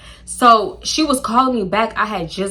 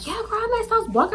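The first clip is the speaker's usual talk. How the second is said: excited friend; 12 semitones higher, 4 dB quieter than usual. Retold or imitated.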